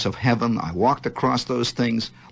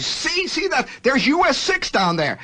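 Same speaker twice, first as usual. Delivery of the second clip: high eager voice; showing off the road below; much higher excited dad; father pointing out; 14 semitones higher than usual. Retold or imitated.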